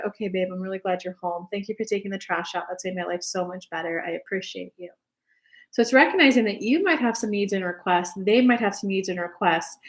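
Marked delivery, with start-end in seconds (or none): 0.1-4.9 soft, low and appreciative; tender gratitude. Acted. Partner thanks him for taking out trash, softly tender. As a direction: say it gently, like a grateful partner.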